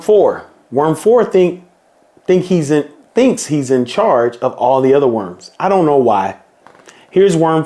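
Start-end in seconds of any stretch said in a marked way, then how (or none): none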